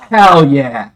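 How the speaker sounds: loud, thrilled gamer outburst; excited shouting. Real, not acted. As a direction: yell it out, pumped and delighted. Streamer hyped by the duel.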